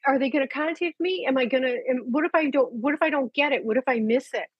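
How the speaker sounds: lightly hammed-up worry; mock panicked people. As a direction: imitate grieving owners getting anxious. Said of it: "people get crazy".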